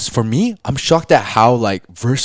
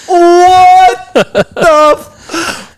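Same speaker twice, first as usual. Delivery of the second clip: shocked; 6 dB louder, much slower than usual. Real, not acted. Reacts to shocking cackle.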